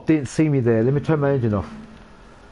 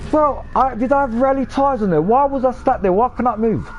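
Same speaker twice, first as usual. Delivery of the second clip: real frustrated outburst; 8 semitones higher than usual. Real, not acted. Streamer to his chat after the car gets stuck, exasperated yelling. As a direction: let the disbelief boil over. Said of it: "Now I'm pissed off".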